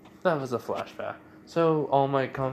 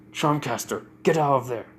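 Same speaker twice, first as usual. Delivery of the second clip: raised, urgent order; urgent command; 2 semitones higher, 4 dB louder than usual. Retold or imitated. Hex telepathically orders Charmcaster to retreat, urgent command. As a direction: call her out of danger.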